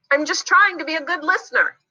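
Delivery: high, quick, earnest voice; eager childlike character, speaking fast; earnest little character. Acted. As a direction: explain yourself in a small high-pitched voice. Z explains itself to Kim.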